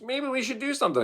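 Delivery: high, quick voice of a restless mind; nagging inner voice. Retold or imitated. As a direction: voice the itch to keep busy. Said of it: "my mind goes".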